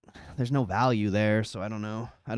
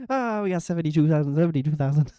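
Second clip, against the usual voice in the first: fast, higher auctioneer patter; 6 semitones higher, 3 dB louder, faster than usual. Pretend. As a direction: rattle off bids like an auctioneer. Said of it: "Use the auctioneer voice".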